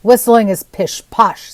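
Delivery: slow, loud gruff dismissal. Acted. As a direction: drag it out like a grumpy old carver. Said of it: "said the woodcarver gruffly".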